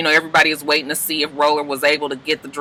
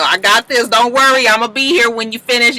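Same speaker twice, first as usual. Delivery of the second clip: high, loud bright reassurance; mock upbeat Jen; reassuring girlfriend; 8 semitones higher, 4 dB louder than usual. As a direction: comfort him eagerly.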